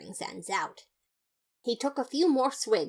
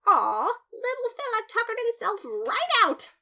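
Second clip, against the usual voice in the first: high, loud, gushing cry over a sleeping brother; excited yell; 5 dB louder than usual. Acted. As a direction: squeal it out, adoring the little sleeper. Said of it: "I yelled".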